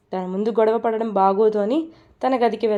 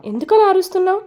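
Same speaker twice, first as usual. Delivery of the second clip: higher, louder angry outburst; angry shout; 4 semitones higher, 6 dB louder than usual. A narrator's reading. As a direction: fire back at her, loud and high. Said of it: "Shiv కూడా fire అవుతాడు". (భార్య మీద కోపంగా అరుస్తూ)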